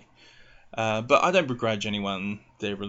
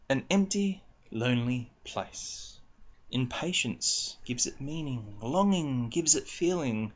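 poem reading; 5 semitones higher than usual. Acted. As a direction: read it like a dark poem.

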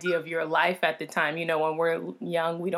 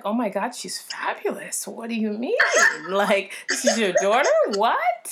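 amazed gush; 4 semitones higher, 4 dB louder than usual. Retold or imitated. Retelling own amazed reaction.

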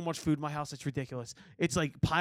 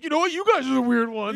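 mock indignant; 6 semitones higher, 5 dB louder than usual. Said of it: "He's like".

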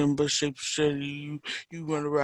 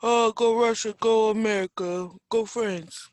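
raised, loud cheer; cheering them on; 7 semitones higher, 4 dB louder than usual. Acted. Friend rooting for both fighters, high loud cheering.

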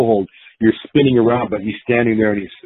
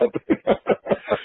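high laugh; 11 semitones higher, 7 dB quieter than usual. Real, not acted. Laughing at his own story.

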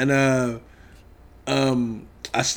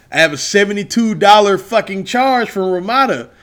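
normal talking voice

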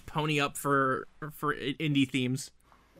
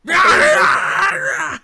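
high, loud roaring rah; dinosaur voice; 13 semitones higher, 11 dB louder, much slower than usual. Retold or imitated. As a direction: roar it like a dinosaur. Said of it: "What was her theme".

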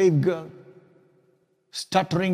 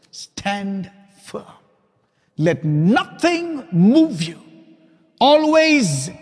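louder, rising sermon reading; proclaiming scripture; 3 semitones higher, 4 dB louder than usual. Acted. Pastor closing with a verse, fervently proclaimed. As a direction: declaim the verse with conviction.

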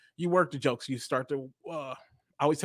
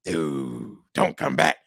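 low ghostly moan trailing into a breathy rasp; much slower than usual. Pretend. Ghost warning visitors away, low moaning. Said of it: "Ghosts say stuff like".

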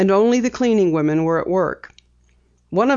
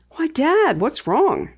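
surprised; 9 semitones higher, slower than usual. A narrator's reading. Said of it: "Penny asked in surprise".

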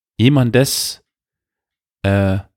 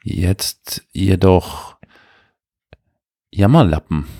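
langsam. Shown as a demonstration. Slowed repetition.

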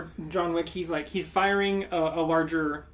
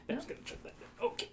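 soft whispered aside off-mic; a quick whispered aside to nobody; 10 semitones higher, 12 dB quieter, much faster than usual. Real, not acted. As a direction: whisper it to yourself while checking the phone. Aside while checking a buzzing phone.

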